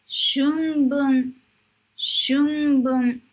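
Shunbun said clearly, with a full n in shun; model pronunciation. Shown as a demonstration. Teacher drilling Shunbun for students, careful and clear. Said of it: "teacher drills the word".

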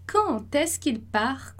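slow, careful French question; slow French model phrase. Shown as a demonstration. Teacher modelling the first phrase for students, slow and careful. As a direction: spell out each sound of "quand est-ce qu'il part?" for the learner. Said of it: "a nossa primeira frase lá".

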